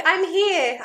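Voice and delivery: hammed-up dramatic arrival line; movie hero entrance. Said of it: "just like in the movies".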